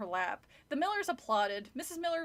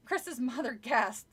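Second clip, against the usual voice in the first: plain reading voice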